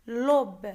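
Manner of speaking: "L'aube" said all at once; one single syllable. Shown as a demonstration. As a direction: land "L'aube" as one strong isolated beat. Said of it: "d'un seul coup".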